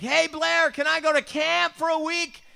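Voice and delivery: very high, loud, drawn-out holler; blaring shout. Retold or imitated. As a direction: bellow the request as if he were deaf. Blaring request at probation officer.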